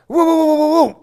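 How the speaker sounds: high, loud rapid mock gunfire; spray the sound out; machine gun burst. Man miming machine gun fire at a lion, loud bursts.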